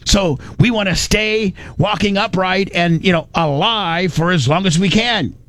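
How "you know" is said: normal talking voice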